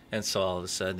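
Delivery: normal talking voice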